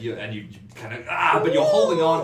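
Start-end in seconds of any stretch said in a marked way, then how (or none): 1.1-1.4 short, high, loud acted cry of pain; pained scream. Game master acting the shocked player, high pained yelp. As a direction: yelp as the jolt hits. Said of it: "can't help but scream out in pain".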